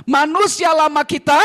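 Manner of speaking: loud call to repeat. Shown as a demonstration. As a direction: lead the crowd in a loud recitation. Leading congregation in loud recitation.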